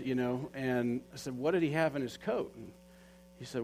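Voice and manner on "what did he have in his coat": retold insistent questioning. Pressing friend for an explanation.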